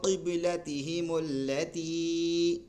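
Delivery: قبلتہم اللتی chanted slowly; measured chant; slow recitation. Shown as a demonstration. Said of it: "حلق سے نکلے گا".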